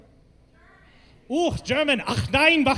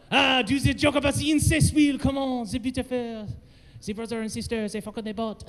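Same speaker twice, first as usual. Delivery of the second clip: put-on French accent; 2 semitones higher than usual. Comedian doing French for an incest joke, French accent. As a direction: play a Frenchman, hushing toward the end. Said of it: "go back to doing French".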